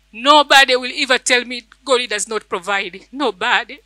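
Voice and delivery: usual speaking voice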